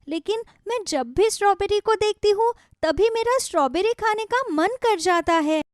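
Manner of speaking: put-on a girl begging for strawberries; bright child voice; excited little girl. Shikha eager for strawberries.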